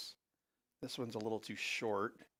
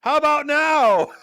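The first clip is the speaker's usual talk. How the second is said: much higher, very loud cheeky question; hammed-up squeaky showman voice; mock presenter voice; 18 dB louder than usual. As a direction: shriek it like a smug parody pitch. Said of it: "he slaps the Copilot name on Office".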